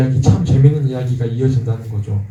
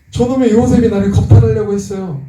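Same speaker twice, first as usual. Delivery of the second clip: high-pitched accusing wail of a wife; wife's loud accusation; 7 semitones higher than usual. Retold or imitated. Preacher plays the wife accusing Joseph to her husband, high-pitched accusation. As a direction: act out the wronged wife telling on him. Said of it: "막 소리 지르면서".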